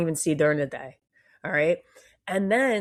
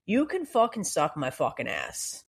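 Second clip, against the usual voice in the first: ordinary talk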